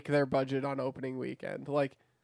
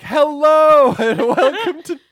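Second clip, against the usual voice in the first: much higher, louder, slow parody, laughing through it; mocking the host voice; 10 semitones higher, 13 dB louder, slower than usual. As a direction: ham up his intro voice while cracking up. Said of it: "Alex was like".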